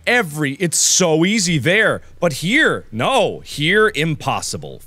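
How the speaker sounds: usual speaking voice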